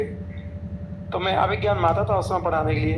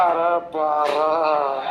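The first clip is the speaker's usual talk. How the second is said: drawn-out groan; 5 semitones lower, much slower than usual. Acted. Exclaiming about the mud.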